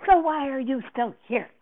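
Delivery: loud, high, angry demand; angrily. A narrator's reading. Director to Miranda still backstage, angrily. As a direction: lash out at someone lingering. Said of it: "Davenport said to me angrily".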